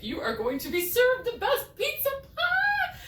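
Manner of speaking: high, loud, bursting announcement; excited. Pretend. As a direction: sell it like the best pizza ever. Excited promise of great pizza.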